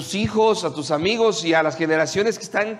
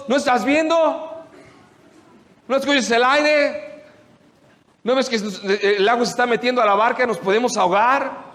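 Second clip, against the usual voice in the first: panicked; 5 semitones higher than usual. Acted. Disciples panic at the storm.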